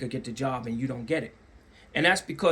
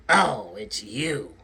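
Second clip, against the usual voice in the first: slow, low, dismissive "Oh, it's you"; slow cold disdain; 7 semitones lower, much slower than usual. Retold or imitated. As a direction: drag it out, unimpressed. Cold reception mimicked.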